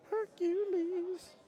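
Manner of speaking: very high, slow, stretched announcer cry; mock-epic arena announcer; epic hero call. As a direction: sing out the name like a legend's entrance. Hyping the hero combo shot.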